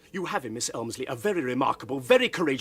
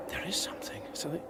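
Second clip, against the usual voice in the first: softer, unvoiced wary whisper; hushed whisper; 11 dB quieter, faster than usual. Piers whispering to Alfred about the smell. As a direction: whisper it, sensing danger.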